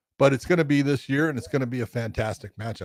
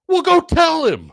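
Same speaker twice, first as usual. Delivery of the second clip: much higher, louder and slower, egging him on; retold wife loudly urging her husband to go tell him; wife's excited urging; 10 dB louder, slower than usual. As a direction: push him to go over and say it.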